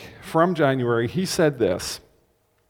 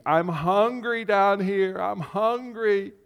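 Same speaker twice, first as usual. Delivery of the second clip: put-on slow, higher whining baby voice; whiny baby; 5 semitones higher, slower than usual. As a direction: whine it like a hungry toddler. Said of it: "will whine like a baby".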